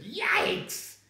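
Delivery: high startled cry; sudden acted shriek of shock; alarmed yelp. Startled exclamation in a story.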